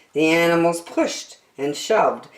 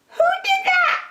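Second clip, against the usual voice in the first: very high, squeaky and put out; indignant squirrel. A narrator's reading. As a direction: squeal it after falling out of bed. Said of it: "asked the squirrel".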